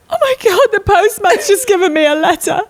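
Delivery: high, wailing mock tears over a letter; mock weepy pregnant self. Mocking her pregnant self to a friend, high mock wail. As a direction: ham up a tearful overreaction, pitched high. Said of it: "it was like".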